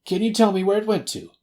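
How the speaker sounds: high, loud, eager plea; emotional cry. A narrator's reading. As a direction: beg anxiously in a high voice. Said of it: "he cried".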